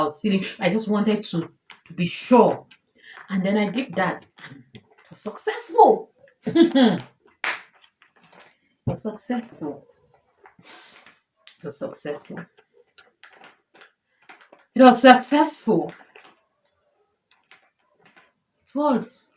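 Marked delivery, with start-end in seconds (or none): 4.7-7.0 high, overwhelmed outburst of relief; emotional. Real, not acted. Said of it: "I'm sorry I'm getting emotional". 8.9-12.4 hushed, overcome, voice fading away; emotional. Real, not acted. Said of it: "I'm sorry I'm getting emotional". 14.7-19.2 high, emphatic, overcome with relief; emotional. Real, not acted. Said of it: "I'm sorry I'm getting emotional".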